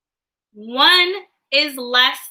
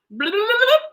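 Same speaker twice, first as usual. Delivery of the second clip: babbled flub; 8 semitones higher, much faster than usual. Real, not acted. Flubbed word, babbles to reset.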